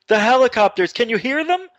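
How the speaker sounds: high-pitched mock yell of an excited dad; retold yelling. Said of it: "my mom and dad are on the phone yelling".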